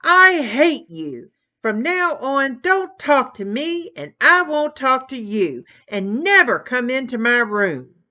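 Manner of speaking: a furious brother screaming at his sister, full rage; yelling at the top of lungs. Acted. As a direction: scream it with everything. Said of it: "yelled at the top of his lungs".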